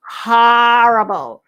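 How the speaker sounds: very slow Horrible, stress put on the wrong part; mimicking his horrible. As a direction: stretch Horrible out, wrong syllable hit. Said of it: "puts the pronunciation on the wrong part".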